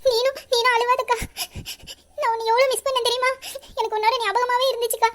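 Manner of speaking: high voice broken by sobs; tearful. Acted. Younger sister telling elder she missed her, tearful. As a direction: pour out the longing through tears.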